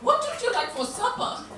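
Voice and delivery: high, sing-song posh mother voice; a posh mum cooing at her toddler; posh woman. Retold or imitated. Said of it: "a very posh woman".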